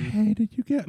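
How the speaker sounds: half-hearted girl voice attempt; man doing a woman's voice. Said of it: "I can't even fucking try".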